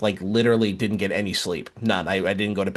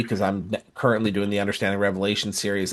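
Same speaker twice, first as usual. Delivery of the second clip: ordinary talk, nothing marked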